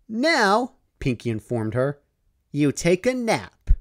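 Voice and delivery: high, chirpy pony voice, upbeat; cheerful Pinkie Pie. Acted. Pinkie reassures Dash she'll do the work, high and chirpy. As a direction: chirp it brightly.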